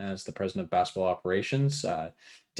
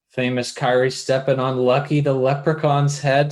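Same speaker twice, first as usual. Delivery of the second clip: ordinary talk, nothing marked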